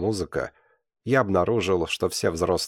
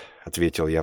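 ordinary delivery, nothing marked

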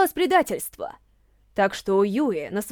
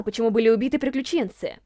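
ordinary delivery

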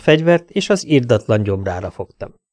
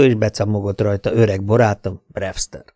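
usual speaking voice

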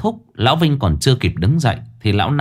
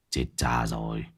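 startled mutter; 12 semitones lower, 12 dB quieter than usual. Acted. Lão Năm startled, mutters.

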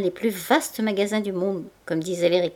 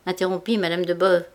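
usual speaking voice

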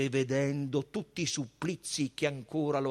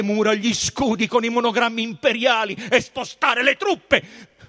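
shouted, high-pitched tirade; furious Pilate; 9 semitones higher, 11 dB louder than usual. A narrator's reading. Pilate threatens Jerusalem with the legion, shouting furiously. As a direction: roar the threats in full fury.

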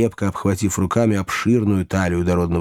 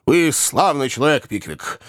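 higher-pitched grateful outburst; hearty exclamation; 5 semitones higher than usual. A narrator's reading. Host thanking his friend for coming along, hearty exclamation. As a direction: exclaim it with feeling, pitch lifted. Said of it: "воскликнул хозяин".

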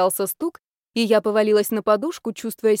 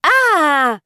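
high, loud, straining yell; frustrated angry shriek; furious scream; 7 semitones higher, 9 dB louder than usual. A narrator's reading. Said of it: "надрывный злобный вопль".

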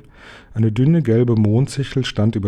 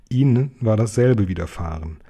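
usual speaking voice

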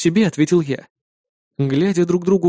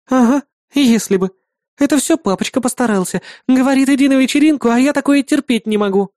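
higher-pitched, mildly peeved woman character; partner Kri, annoyed; 8 semitones higher than usual. Acted. Partner forced to a party by her father, complaining.

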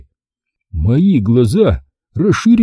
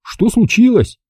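higher-pitched puzzled question; surprised; 10 semitones higher than usual. A narrator's reading. Boy asks dad on the phone, surprised. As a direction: ask what's wrong, pitch jumping up. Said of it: "удивился я".

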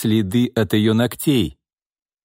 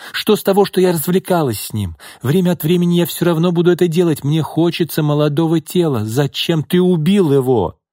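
scornful mistress; 7 semitones higher than usual. Acted. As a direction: throw it at him, scornful. Lina voiced, high.

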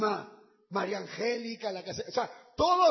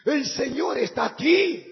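shouted proclamation, louder; 4 semitones higher, 9 dB louder than usual. Retold or imitated. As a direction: proclaim it at full voice. Repetición gritada. (proclamando a gritos)

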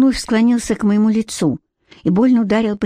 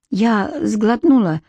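usual speaking voice

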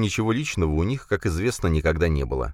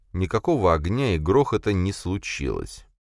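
plain reading voice

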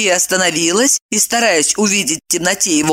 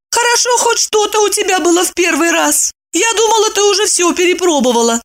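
sarcastic; 13 semitones higher than usual. Acted. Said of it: "съязвила Евдокия".

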